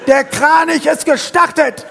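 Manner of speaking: Der Kranich ist gestartet! shouted very high, loud and slow; slow loud triumphant cry; triumphant proclamation. Retold or imitated. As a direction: declare it like a secret code word. Triumphant phone message.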